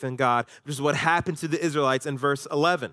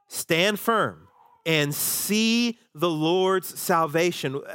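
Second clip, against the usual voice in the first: slow emphasis; 2 semitones higher, much slower than usual. Shown as a demonstration. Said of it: "sermon emphasis".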